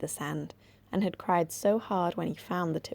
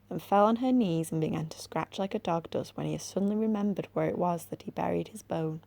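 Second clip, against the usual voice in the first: plain reading voice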